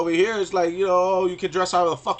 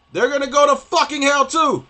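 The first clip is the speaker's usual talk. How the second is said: higher and louder, hurling the verdict; loud condemnation; 10 semitones higher, 7 dB louder than usual. Real, not acted. Warning that men will go to hell, loud ranting. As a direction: shout it like a damnation.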